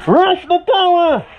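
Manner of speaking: loud rallying yell; shouting orders. Real, not acted. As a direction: shout it across the field. Calling troops to push.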